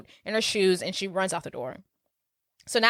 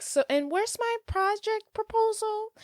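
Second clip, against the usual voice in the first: high, soft, snooty drawl; mean girl voice; 12 semitones higher, 5 dB quieter than usual. Retold or imitated. Recapping the movie, she mimics the mean girl demanding her project. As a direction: play the queen bee fishing for her homework. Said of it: "she's like".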